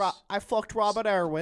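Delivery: loud hammed-up Aussie voice; a hint of an Aussie bloke; Australian accent. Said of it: "Uh, Australian".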